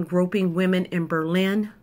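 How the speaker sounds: ordinary talk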